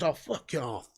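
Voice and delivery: petulant throwaway fuck off; dismissive mutter. Retold or imitated. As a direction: mutter it like a sulky teen. Maguire's dismissive reply retold.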